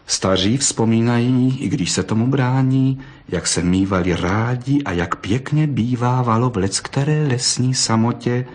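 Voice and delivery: usual speaking voice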